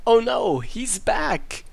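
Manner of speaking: mock alarm. Character panics at villain's return.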